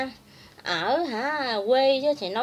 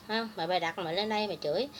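normal talking voice

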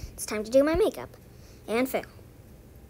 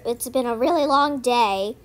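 ordinary talk, nothing marked

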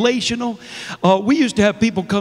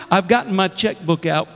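ordinary talk

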